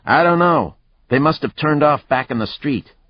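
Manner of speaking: high, loud reply; shouting back. Acted. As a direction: shout the answer back across the snow. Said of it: "Static shouted back".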